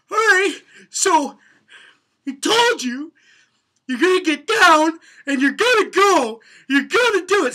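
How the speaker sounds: high, loud, pumped-up delivery; loud coach yell. Shown as a demonstration. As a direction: fire up the crowd with a yell. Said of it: "demonstrates the motivational speaker delivery".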